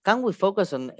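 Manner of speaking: ordinary delivery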